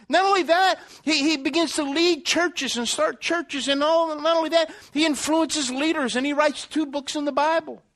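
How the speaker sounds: high, driving sermon delivery; impassioned preaching. Real, not acted. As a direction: preach it with mounting conviction and ringing emphasis. Preacher builds at full force.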